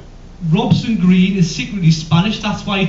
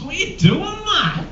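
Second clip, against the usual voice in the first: exasperated; 10 semitones higher, 4 dB quieter than usual. Acted. Mocking flip-flop wearers.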